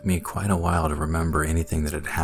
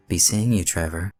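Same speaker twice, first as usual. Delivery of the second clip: lightly feminine farewell; soft feminine voice; 3 semitones higher than usual. A narrator's reading. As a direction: call out with a hint of femininity. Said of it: "soft and feminine, call out to me".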